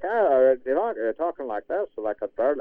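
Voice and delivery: lightly imitating a kind Irish brogue; Irish club president. A hint of Irish brogue as the club president welcomes him. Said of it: "But he was so Irish".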